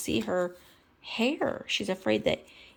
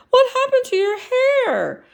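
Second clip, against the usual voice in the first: shocked Kermit voice; 9 dB louder than usual. Acted.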